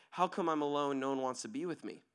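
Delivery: low, softened self-pitying complaint; depressed. Acted. Lonely person wondering why nobody stays, mild acted dejection. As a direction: let it sink, mock dejected. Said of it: "we get depressed and say".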